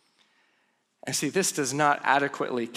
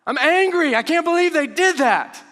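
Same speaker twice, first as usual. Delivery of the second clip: loud, high, hammed-up anger; loud, higher mock outrage at a hit-and-run driver; mock angry outburst; 10 semitones higher, 7 dB louder than usual. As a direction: shout it like a driver whose car got hit. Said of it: "You'd be like".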